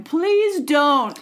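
mock pleading. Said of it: "are going".

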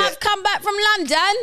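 high, hammed-up London accent; mock London accent. Aussie back from London, mock London accent. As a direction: squeal it in a fake London accent. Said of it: "ones that go over to London".

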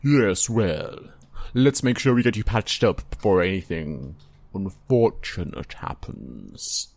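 toned-down skeleton character, falling low at the end. Acted. Skeleton Sandra offers to patch someone up, toned down. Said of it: "find Sandra a slightly more tolerable voice".

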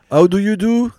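French speaker's loud, high go at English; bad English accent. Shown as a demonstration. Guest showing the host his English accent, clumsy and loud. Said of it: "t'es dégueulasse en anglais, en accent".

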